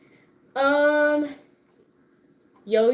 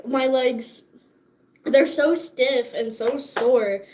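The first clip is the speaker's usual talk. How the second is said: ordinary delivery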